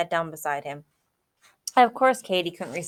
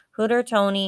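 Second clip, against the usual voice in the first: ordinary delivery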